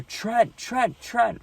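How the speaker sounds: high, urgent calling out of a name; calling his name. Retold or imitated. Friends calling his name after crash.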